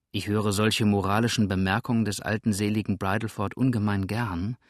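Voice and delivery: ordinary delivery, nothing marked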